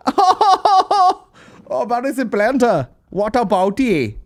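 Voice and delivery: high, loud mock-German exclamation; boisterous German-accented parody; imagined German fan. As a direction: laugh into a hammy German accent. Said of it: "imagine I was leaving a gig and some guy's like".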